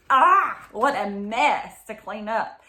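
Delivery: ordinary delivery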